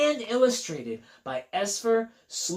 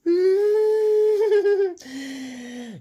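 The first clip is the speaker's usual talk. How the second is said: high giggle; 13 semitones higher, 7 dB louder than usual. Acted. Laughing in the story.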